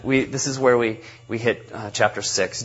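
ordinary talk, nothing marked

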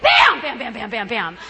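bang bouncing away, dropping in pitch and volume; imitated ricochet; ricocheting bang imitation. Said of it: "the sound ricochets around the bathroom".

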